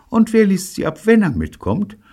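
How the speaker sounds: high, loud, sugary question; sugar-sweet. A narrator's reading. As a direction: ask it with pointed sweetness. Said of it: "fragte Betty zuckersüß". (zuckersüß, mit hoher Stimme)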